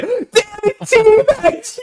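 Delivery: very high, cracking yell into a laugh; mock rage. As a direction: rage at the teabag half-joking. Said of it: "I'll be like".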